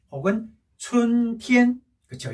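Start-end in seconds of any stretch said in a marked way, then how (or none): 0.8-1.8 "춘티엔" very slow, two level held notes; double first tone. Shown as a demonstration. Teacher drilling spring, "춘티엔" slow and level. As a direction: chant "춘" and "티엔" steadily on one pitch. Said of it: "이렇게요".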